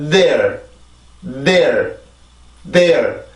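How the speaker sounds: "there" as one syllable with a long voiced TH; English TH onset. Shown as a demonstration. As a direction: build sound in the TH, stress the vowel, relax into R. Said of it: "이렇게 해서 발음이 돼야 돼요". ("there"의 앞소리를 충분히 낸 일음절 발음)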